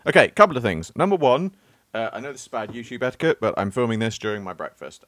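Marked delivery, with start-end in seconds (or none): none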